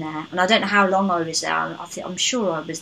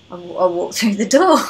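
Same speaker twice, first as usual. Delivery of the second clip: laughing while speaking; 5 dB louder than usual. Retold or imitated. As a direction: chuckle through the words. Retelling awkward moment with priest.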